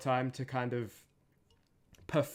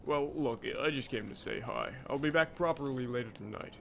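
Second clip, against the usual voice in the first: Jack of all trades; 3 dB quieter than usual. Shown as a demonstration. Voice actor demonstrates a character from Spare the Rod, lightly put-on voice. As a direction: try out the character with a subdued voice. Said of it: "how I got the Jack of all trades voice".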